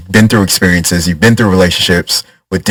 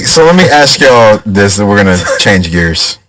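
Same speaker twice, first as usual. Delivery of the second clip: ordinary talk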